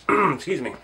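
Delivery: slightly hoarse, voice giving out. Real, not acted. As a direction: talk through a hoarse, tired throat. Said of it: "losing my voice".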